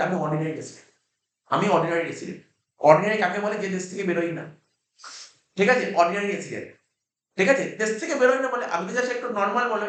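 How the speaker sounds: ordinary delivery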